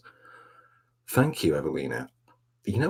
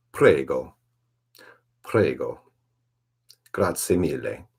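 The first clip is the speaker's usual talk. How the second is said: loud, punchy faked Italian; hammed-up Italian accent; 8 dB louder, slower than usual. Shown as a demonstration. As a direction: play at being Italian, loudly. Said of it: "My Italian accent doesn't exist".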